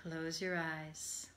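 calm, measured meditation voice with long pauses; guided meditation. Acted. Yoga teacher settling listeners for Yoga Nidra, guided meditation. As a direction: ease them gently into rest, phrase by phrase.

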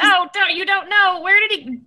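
high, urgent denial; agitated protest. Acted. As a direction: cut in, upset and insistent. Character refuses to believe bad news.

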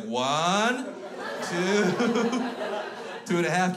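put-on loud, high child countdown; defiant four-year-old. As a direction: threaten like a tiny tough guy. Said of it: "this little four-year-old son of a bitch".

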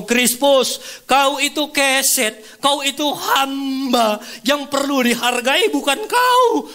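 thundering divine rebuke, high and slow; loud, drawn-out, high-pitched scolding; Roh Kudus. Retold or imitated. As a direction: cry out each word as a stern reproof. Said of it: "Sepertinya Roh Kudus ngomong".

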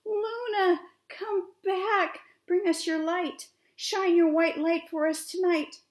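high, loud plea to someone far away; calling out. A narrator's reading. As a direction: call up into the sky, pleading.

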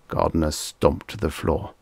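ordinary delivery, nothing marked

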